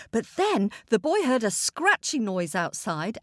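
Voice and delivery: plain reading voice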